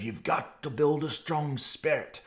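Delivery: mock New Zealand accent in a quoted line; Ian Clayton impression. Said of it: "it's a horrible imitation".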